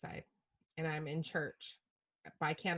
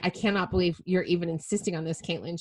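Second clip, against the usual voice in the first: exasperated; 10 dB louder than usual. Retold or imitated. Retold fight with her daughter.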